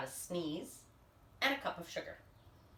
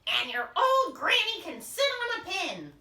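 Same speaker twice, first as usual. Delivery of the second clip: higher, louder insulting yell; pig yelling; 9 semitones higher, 7 dB louder than usual. Acted. Pig yelling an insult about granny, loud and high. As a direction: hurl the insult at the wolf. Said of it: "the third little pig yelled".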